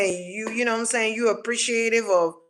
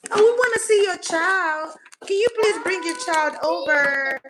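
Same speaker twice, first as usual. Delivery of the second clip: mock sugary plea, much higher; fake-sweet relatives; 6 semitones higher than usual. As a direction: ham up a sickly-sweet fake invitation. Mocking manipulative relatives' request.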